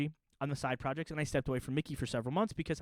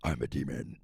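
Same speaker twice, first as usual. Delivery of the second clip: much lower growling demon; 14 semitones lower than usual. Acted. Switching from the mouse to a deep demon voice. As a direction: drop into a menacing demon. Sudden low growl after Mickey.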